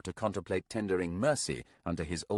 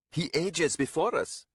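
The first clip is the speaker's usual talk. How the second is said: high, theatrical lament; mocking old companion; 10 semitones higher than usual. A narrator's reading. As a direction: join in the teasing with mock pity.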